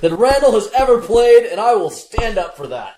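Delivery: loud, high impassioned defence; mock indignation. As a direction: protest loudly in mock outrage.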